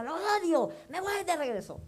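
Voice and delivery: high, upset retold yell; angry shouting. Refusing her father's calming words, high angry yell. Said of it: "gritando y diciendo".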